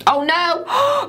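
high-pitched melodramatic alarm; hammed-up, high cry of horror; dismayed squeal. Eyeliner starts leaking.

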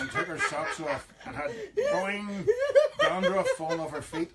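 high, laughing incredulous question; laughing disbelief. Real, not acted. Host laughing at the hairy-feet idea, high and amused. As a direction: squeal it out through laughter at the thought.

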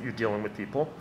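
ordinary talk, nothing marked

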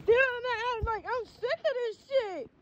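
tearful, voice breaking; choked-up venting through tears; crying. Real, not acted. Crying in car about teeth.

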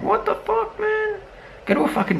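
jumps way up into a squeaky disbelieving yelp; what the fuck, squeaked in disbelief; squeaky outraged yelp; exasperated high squeak. Real, not acted. Exasperated outburst at spitting fans.

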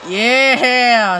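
excited cheer. Real, not acted. Player hoping to spot someone, excited cheer. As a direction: burst out cheering.

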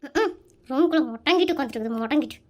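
mimicking mother-in-law sarcastically; mocking. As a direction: parrot her words back in a sneering low drone. Wife mocks mother-in-law's complaint.

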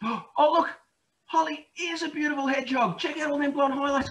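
high, fast, gushing admiration; excited gushing. Acted. Telling the children to admire Holly, high and quick. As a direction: gush over her like a fan.

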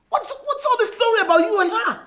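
high, loud accusing outburst; angry shout. Acted. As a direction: explode at him with the question. Wife repeats accusation, now shouting.

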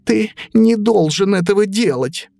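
high frightened piglet voice; high pig voice, pleading. Acted. Piglet begs the wolf.

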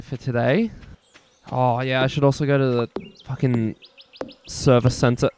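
ordinary delivery

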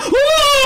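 loud sudden gasp; sharp startled gasp. Real, not acted. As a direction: gasp in real fright. Startled by game jump scare.

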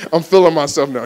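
hyped laughter; laughing. Real, not acted. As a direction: laugh with swagger. Laughing at his own hype.

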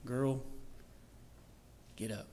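quiet, unhurried summons; gentle command. Acted. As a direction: softly tell her to rise. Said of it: "and says".

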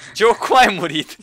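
high, slow mock-shocked question; mock surprise. Real, not acted. Teasing a friend who just died in the game, mock shock. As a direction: draw it out in fake disbelief.

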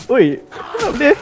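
high startled laugh, slow; scared giddy laughing. Real, not acted. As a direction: laugh nervously while calling out. Startled by zombies.

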